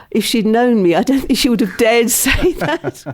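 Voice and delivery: tickled by her own story; amused, laughing. Real, not acted. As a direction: let a laugh slip into the end.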